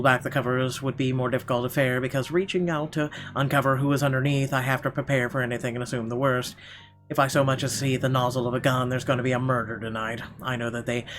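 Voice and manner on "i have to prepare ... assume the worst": ordinary delivery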